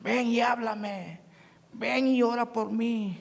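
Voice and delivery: slow, drawn-out jeering call; mocking taunt. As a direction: drag the words out to mock the preacher. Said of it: "me decía horrible".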